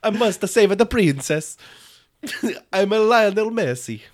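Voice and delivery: high, loud goofy game voice; cartoon game hero voice. Friend goofing as a video game plumber, high and loud.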